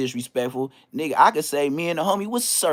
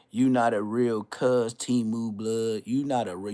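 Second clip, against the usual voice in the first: mocking quote; 5 semitones lower, 5 dB quieter, slower than usual. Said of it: "What, what, uh".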